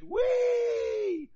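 high, loud squeal of delight; a crowd cheering as they run out, whooping high and loud; excited whoop. Pretend. People running out to meet God, a high loud whoop.

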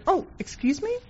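high, offended "Oh, excuse me?" with a lifted pitch; mock-indignant "excuse me", pitched well up. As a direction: retell a shocked, affronted comeback. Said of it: "And I said".

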